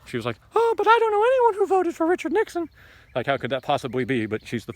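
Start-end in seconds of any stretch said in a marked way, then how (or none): 0.5-2.7 very high-pitched, louder caricature of a clueless editor; mock fashion editor. Fashion editor baffled that Richard Nixon won election, high mocking voice. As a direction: squeal it out as a mocking impression. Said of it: "fashion editor for the New York Times".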